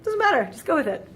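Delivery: high, breezy brush-off; dismissive rule-makers. Retold or imitated. Scene: French rule-makers brushing off a student, breezy and high. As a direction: wave the question away. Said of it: "they're like".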